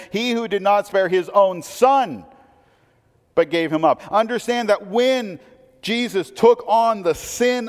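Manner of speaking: full-force preacher with rising urgency; fervent preaching. Acted. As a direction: drive the point home to the congregation.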